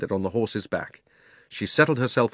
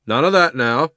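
a firm, louder warning; stern; 5 semitones higher, 7 dB louder than usual. Acted. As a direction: cut the nonsense short. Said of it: "said the policeman sternly".

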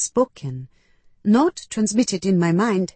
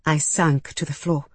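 ordinary delivery

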